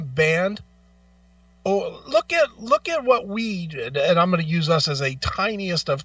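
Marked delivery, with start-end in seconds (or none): none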